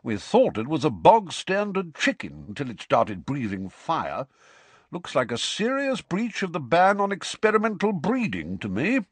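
a worried ministry wizard; bearded wizard voice. Acted. Bob the bearded wizard speaking.

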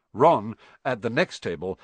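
ordinary delivery, nothing marked

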